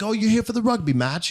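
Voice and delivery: high-pitched imitated British accent. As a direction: ask as a chatty British stranger. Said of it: "like, this British accent".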